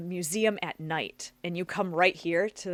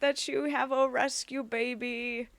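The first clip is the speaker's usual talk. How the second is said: cooing; 7 semitones higher, 5 dB louder, slower than usual. Real, not acted. Cooing over rescue pet.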